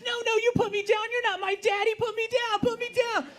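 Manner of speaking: put-on high child's scream; daughter screaming. Little girl screaming at daddy in the parking lot, high shrill scream. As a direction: shriek like a kid having a tantrum. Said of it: "She starts screaming at the top of her lungs".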